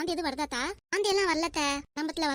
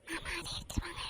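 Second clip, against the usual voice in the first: whispered aside, very soft; secret whisper; 2 semitones higher, 10 dB quieter than usual. Acted. Daughter-in-law secretly asks uncle to fetch the surprise, whispering. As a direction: whisper it so only he hears.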